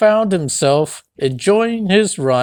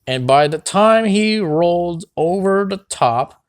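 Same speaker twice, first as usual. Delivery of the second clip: usual speaking voice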